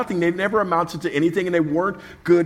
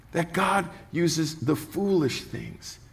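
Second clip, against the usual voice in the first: soft, slow testimony; 7 dB quieter, much slower than usual. Shown as a demonstration. Pastor repeating his testimony to the congregation, soft and slow. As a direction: drop to a soft, slow, reverent near-murmur.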